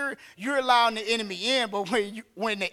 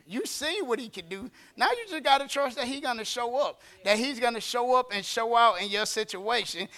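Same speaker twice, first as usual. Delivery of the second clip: normal talking voice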